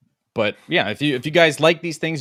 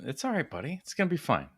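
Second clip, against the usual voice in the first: mock soothing; 4 semitones higher, 8 dB quieter, faster than usual. Teasing friend about nerves.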